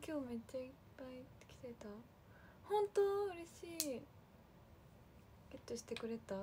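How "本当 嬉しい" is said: surprised delight. Real, not acted. Fans report buying merch.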